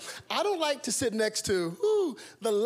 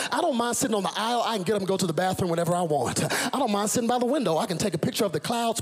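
usual speaking voice

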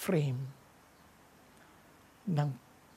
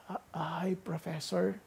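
faint, much softer greeting; weak hello; 9 dB quieter, slower than usual. Retold or imitated. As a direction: barely voice it, like a dying man. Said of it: "Hi, Tim," sabi ng professor". (mahinang-mahinang bati)

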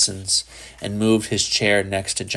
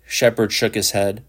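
ordinary delivery, nothing marked

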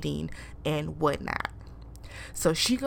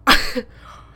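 laugh; 5 semitones higher, 10 dB louder than usual. Real, not acted. Laughing at the question.

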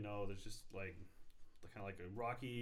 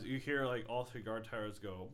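ordinary delivery

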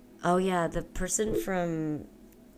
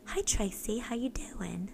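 soft, higher British greeting; British accent; 4 semitones higher, 5 dB quieter, slower than usual. Shown as a demonstration. Showing a friend how the caller sounds, British accent.

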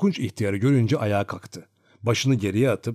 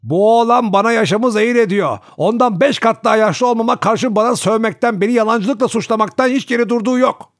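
put-on much higher, louder aggrieved old coachman; whining complaint; 11 semitones higher, 8 dB louder than usual. A narrator's reading. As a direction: complain loudly to grandma. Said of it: "Piotr yakınmaya başladı".

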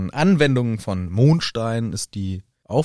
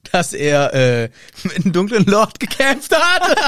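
mocking, high-pitched voice breaking into laughter; spöttisch lachend; 9 semitones higher, 6 dB louder than usual. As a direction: laugh through the words, climbing louder and higher. Spottendes Nachäffen unter Lachen. (spöttisch, lachend und immer höher)

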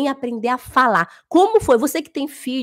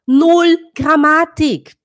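loud slow slogan; 4 semitones higher, 6 dB louder, much slower than usual. Real, not acted. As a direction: punch out each word slowly. Opening mock slogan, loud slow.

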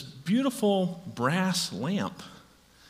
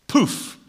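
poof sound effect; 9 semitones higher, 4 dB louder than usual. Retold or imitated. Magic sound in joke.

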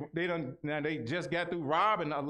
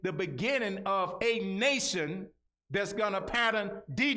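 booming motivational speech; 5 semitones higher than usual. Acted. Preaching at full force.

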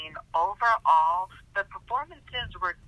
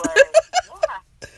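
laughing; 12 dB louder than usual. Real, not acted. Laughing together.

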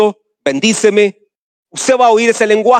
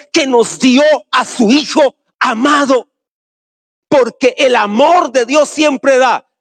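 fervent preaching; 4 semitones higher, slower than usual. Acted. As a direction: proclaim God's love to the church. Preaching climax.

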